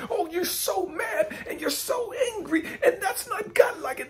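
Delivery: high, whiny mocking voice of his critics. Preacher mocks churchgoers who call him angry, in a high whiny sneer. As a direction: sneer it back in a sing-song, like a pious scold. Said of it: "when they see you talking like this".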